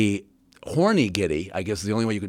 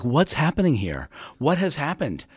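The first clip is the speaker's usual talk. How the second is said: higher-pitched, mildly bewildered questions; imitated dazed confusion; 6 semitones higher than usual. Actor recalls co-star's confused reaction in the scene, mock dazed. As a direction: play at being lost and confused. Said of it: "so dazed and confused".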